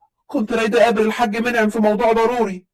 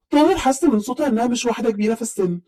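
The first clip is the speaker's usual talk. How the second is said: ordinary delivery, nothing marked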